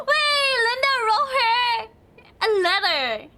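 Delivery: high, loud shocked exclamation; surprised. Real, not acted. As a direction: blurt it out in disbelief. Shocked discovery of letter.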